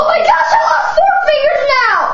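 panicked high shout. Pretend. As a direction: cry out in horror. Pretend injury, losing fingers.

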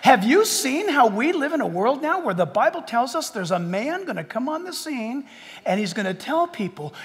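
usual speaking voice

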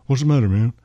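amateur imitation of the singer's speech. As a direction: have a firmer go at the famous Southern star's voice. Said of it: "I can't imitate Elvis. I wish I could".

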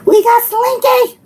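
high, giddy cheer; excited announcement. Real, not acted. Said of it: "I'm a little excited".

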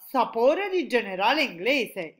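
interrupting exclamation. A narrator's reading. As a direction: cut in loudly. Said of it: "interruppe di nuovo il G G G". (interruzione esclamata ad alta voce)

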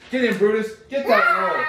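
the hunter sending his dog at the wolf, loud command; commanding shout. Acted. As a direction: order the dog in at full voice.

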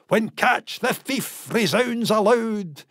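ordinary delivery

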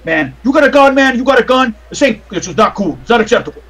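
a touch of worked-up indignation; agitated doorman. Acted. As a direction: confront a suspect while frisking him. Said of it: "he starts patting you down".

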